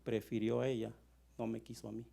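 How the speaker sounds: low, subdued hurt; sad rejection. Acted. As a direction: voice a rejected partner, quietly wounded. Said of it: "qué triste sería". (con tristeza, en voz baja y dolida)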